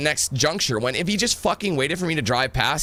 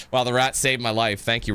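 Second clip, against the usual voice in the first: ordinary talk, nothing marked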